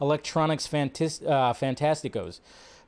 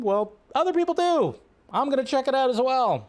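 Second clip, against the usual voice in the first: raised, eager comeback; excited retort; 10 semitones higher than usual. Real, not acted. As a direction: answer back with a high, eager voice. Retorting to a critic.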